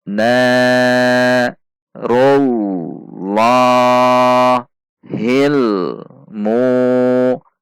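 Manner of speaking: very slow, syllable by syllable with held long vowels; measured, drawn-out model reading; Quran recitation demo. Shown as a demonstration. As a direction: recite slowly, separating long and short syllables. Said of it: "Mari perhatikan panjang dan pendek bacaan".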